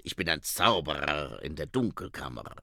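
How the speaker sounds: put-on low, accented vampire voice; Otto Schreck. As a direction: drop low for the boastful vampire. Vampire boasts in story. (tiefe Vampirstimme)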